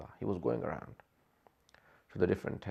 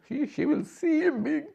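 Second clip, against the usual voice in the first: breaking down crying. Real, not acted. Preacher foretelling the sister seeing her brother slain, sobbing. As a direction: voice leaps high into tears.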